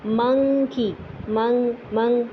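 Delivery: منکی with nasal ng, tongue not on palate; nasal monkey. Shown as a demonstration. Teacher demonstrating ikhfa sound. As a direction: hum the ng in منگ through the nose. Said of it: "ایک لفظ بولتے ہیں منکی".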